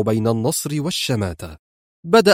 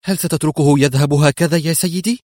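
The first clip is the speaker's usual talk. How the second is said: higher, indignant question; resentful; 4 semitones higher than usual. A narrator's reading. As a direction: protest with a touch of outrage. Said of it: "قالت منى بحنق".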